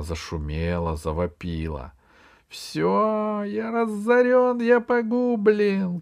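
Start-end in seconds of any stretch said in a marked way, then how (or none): 2.5-6.0 very high, drawn-out wail of ruin; despairing yell. A narrator's reading. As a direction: cry out as if all is lost. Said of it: "кричал пигмей". (отчаянный протяжный вопль)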